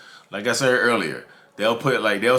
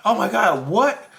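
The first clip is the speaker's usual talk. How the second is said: high, loud burst of disbelief; mock shock; 11 semitones higher, 5 dB louder, slower than usual. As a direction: act out stunned outrage, pitch shooting up. Acts out reaction to cheating confession.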